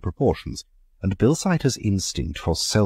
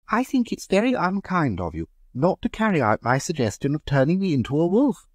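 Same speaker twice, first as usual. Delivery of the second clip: high-voiced mock reproach; teasing complaint. A narrator's reading. Hostess teasing Clovis about the wolf, mock reproachful. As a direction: chide him lightly for refusing. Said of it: "said Mary Hampton".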